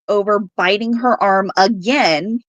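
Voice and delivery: ordinary delivery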